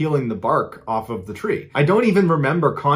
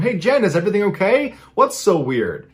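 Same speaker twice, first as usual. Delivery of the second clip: mild call into the next room, pitched up at first; mock yell; 7 semitones higher than usual. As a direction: imitate a mother calling through the house, without full volume. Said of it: "she yells into the room".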